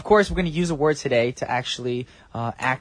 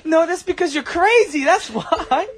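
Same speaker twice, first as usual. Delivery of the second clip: hammed-up boyfriend; 9 semitones higher than usual. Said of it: "start off this little acting thing".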